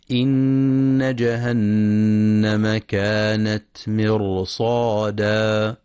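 Quran recitation. Shown as a demonstration. Teacher recites the verse for students, slow solemn chant. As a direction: draw out مرصادا with a heavy ra.

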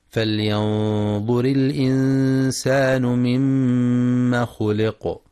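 slow, measured tajweed chant; sustained, level-toned tajweed recitation; Quran recitation. Acted. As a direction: recite with long, held vowels and nasal hums.

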